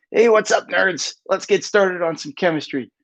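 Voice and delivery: high-pitched nerd voice; nerdy greeting. Acted. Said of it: "it counts as being nerdy".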